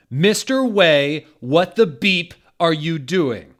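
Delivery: high, loud, slow furious yell at a student; angry shout. Acted. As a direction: bellow it at him in outrage. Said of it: "Rumbridge shouted angrily".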